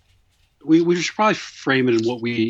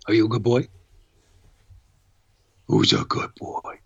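cooing to a dog; friendly dog talk, partly whispered; pet talk; 2 semitones lower than usual. Real, not acted. Talking to the dog.

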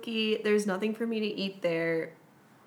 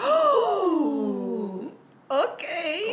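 high gasp, then a stunned okay; gasping surprise; 8 semitones higher, 5 dB louder, much slower than usual. Real, not acted. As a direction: react in shock to the answer. Shocked at friend's answer.

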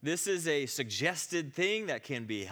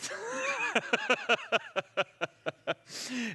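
long, high, loud laugh; big laugh; 12 semitones higher, 5 dB louder than usual. Real, not acted. Speaker delighted that the awkward prayer worked, laughing loudly. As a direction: burst out laughing.

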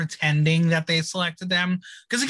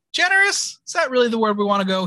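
loud, high-pitched incredulous question; 4 semitones higher, 4 dB louder, faster than usual. Real, not acted. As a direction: pounce on the word in disbelief. Mock disbelief at [name] called generous.

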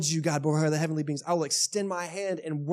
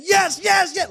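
much higher and louder, ecstatic yell; reenacted goal scream; 14 semitones higher, 9 dB louder, slower than usual. Soccer fan reenacting his goal celebration, screaming yes. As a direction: shout it like the winning goal just went in. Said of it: "sprinting around the house screaming".

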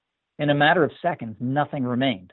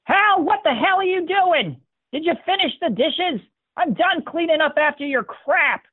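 shrill fed-up wife yelling; nagging wife. A narrator's reading. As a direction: berate him from upstairs, much higher.

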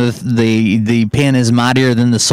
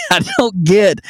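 higher-pitched, laughing in disbelief; exasperated laughing; 5 semitones higher than usual. Real, not acted. Fed-up host laughing to avoid a rage, real exasperated laugh. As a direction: laugh it off to keep from raging. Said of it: "I'm laughing to quit".

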